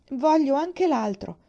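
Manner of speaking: put-on high, loud, slow child's demand; stubbornly wanting the baby; insistent plea. A narrator's reading. (bambina che insiste, acuta e lenta)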